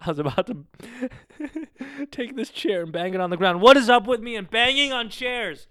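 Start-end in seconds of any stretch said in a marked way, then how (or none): 1.4-2.1 nervous giggle. Real, not acted. Laughing at his own reaction.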